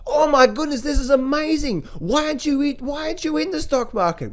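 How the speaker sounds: a quote of the euphoric crowd, voiced high and gushing; euphoric investor. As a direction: play the giddy crowd egging you to buy. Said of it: "if we did hear that".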